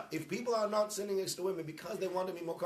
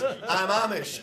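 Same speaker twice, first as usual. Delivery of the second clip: louder, laughing as he repeats it; laughing punchline; 3 semitones higher, 8 dB louder, much slower than usual. Retold or imitated. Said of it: "He goes".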